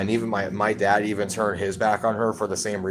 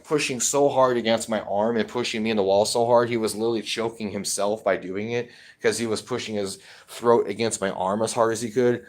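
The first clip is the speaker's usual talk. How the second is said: plain reading voice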